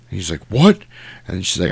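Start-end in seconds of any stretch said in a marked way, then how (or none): 0.5-0.8 high, loud yelp of a question; mock-shocked outburst; startled disbelief. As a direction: blurt it out, pitch jumping up. Voices Freddy's startled reaction.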